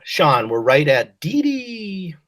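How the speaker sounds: usual speaking voice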